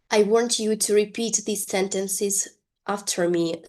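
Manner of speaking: usual speaking voice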